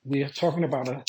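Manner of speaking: ordinary delivery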